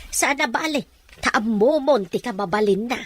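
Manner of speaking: usual speaking voice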